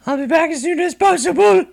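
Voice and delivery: character voice. Pretend. Reading game dialogue in a voice.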